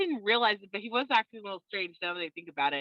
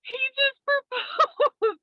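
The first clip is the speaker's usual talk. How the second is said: high, slow and laughing through it; giddy with shock, a laugh breaking in; laughing disbelief; 6 dB louder, much slower than usual. Retold or imitated. Telling photographer about proposal.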